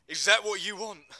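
loud, high, furious challenge; enraged. Acted. Johnson confronting Teddy before punching him, enraged shout. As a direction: hurl the question at him.

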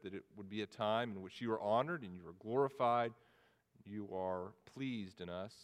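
soft, low pastor's prayer. Acted. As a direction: pray humbly to the Father.

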